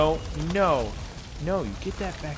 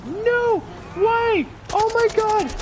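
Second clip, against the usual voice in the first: anguished shout; 5 dB louder, much slower than usual. Real, not acted. Bear attacks his companion.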